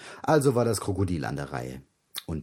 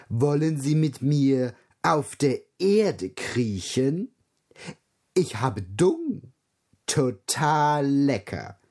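higher, slow lightly voiced giraffe reading; 6 semitones higher, slower than usual. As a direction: read with a touch of giraffe. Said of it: "Und die Giraffe las".

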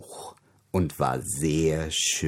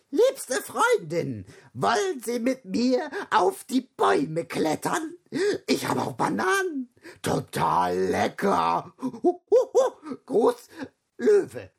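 much higher, giggly monkey reading a love letter; squeaky monkey voice, giggling through the letter; cheeky monkey voice. Acted. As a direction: read it as a silly grinning ape. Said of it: "Der Affe las".

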